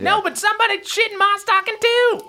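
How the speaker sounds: very high squeaky elf voice; insistent crick elf. Acted. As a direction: pipe up indignantly as a tiny creature. Another elf insists on complaint.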